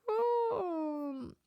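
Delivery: long high, soft "um"; drawn-out thinking hum, high and quiet; pondering hum. Real, not acted. Host trying to remember the last time, pondering hum.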